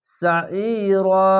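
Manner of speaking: সাইরা very slow with a heavy throat-squeezed আইন; long, throaty chanted সাইরা; throat-pressed recitation. Shown as a demonstration. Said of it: "আইনটিকে মোটা করে গলা চিপে পড়বেন".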